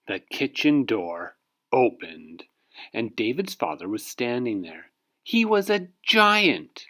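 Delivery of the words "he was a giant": dramatic reveal. A narrator's reading. Reveals the giant.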